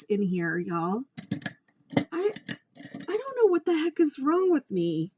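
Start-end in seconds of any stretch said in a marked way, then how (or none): 2.1-5.1 frustrated. Real, not acted. Frustrated outburst.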